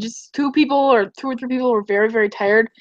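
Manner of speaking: usual speaking voice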